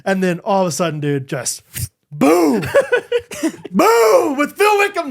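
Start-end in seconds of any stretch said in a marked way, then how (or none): 2.1-5.1 high, loud burst of joy; triumphant shout. Pretend. Dad celebrating the birth, loud triumphant shout. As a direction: celebrate like a drop.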